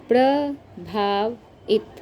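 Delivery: प्रभावित pulled apart slowly; teacher stretching the word; very slow sounding out. Shown as a demonstration. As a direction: spell out प्रभावित by its parts. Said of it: "teacher sounds out word slowly".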